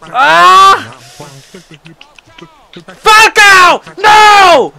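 high, very loud outburst; furious shout. Real, not acted. Gamer raging at his player's miss, furious high shouting. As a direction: yell at the player who blew it.

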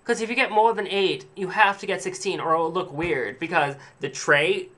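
mock a stuck-up shop worker; snobby saleswoman. Snobby clerk insisting the customer get sixteen. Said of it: "So the woman's like".

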